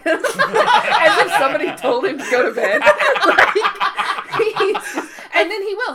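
high, loud, laughing; laughing hard. Real, not acted. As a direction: barely get through laughing.

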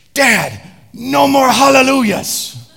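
put-on loud, high, slow toddler shout; angry toddler. Son yelling at dad to stop singing, loud mock toddler rage. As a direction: stomp and yell like a furious two-year-old. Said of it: "He's so angry".

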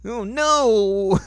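high, loud "oh no" of mortification; mock embarrassed wail. Co-host voices a blushing girl, mock embarrassed wail. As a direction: wail it, hammed up. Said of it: "and I'm embarrassed".

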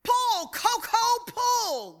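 high, drawn-out call to the mule; farmer calling out. Retold or imitated. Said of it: "Then he said".

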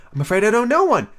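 put-on high, mildly alarmed girl; understated alarm; rather alarmed. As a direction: protest it high, a touch flustered. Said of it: "rather alarmed at the proposal".

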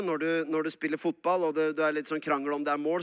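ordinary talk

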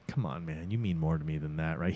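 low, soft mock sweet-talk; smooth-talk her, hamming it up; imitating a sleazy charmer; laying it on thick. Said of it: "he's laying it on thick".

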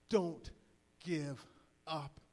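one word at a time, long pauses; measured, separated DON'T... GIVE... UP; solemn appeal. Real, not acted. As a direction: let each word land, low and earnest. Closing line of appeal.

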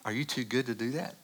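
soft, level motherly question; a mother's quiet, unruffled reply; calm. Retold or imitated. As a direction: ask it calmly, a gentle challenge. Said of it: "Mom very calmly said".

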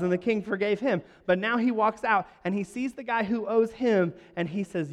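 ordinary talk, nothing marked

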